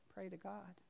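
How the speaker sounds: softly repeated inner command. Retold or imitated. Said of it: "except it didn't come from my throat".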